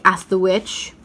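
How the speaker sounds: ordinary delivery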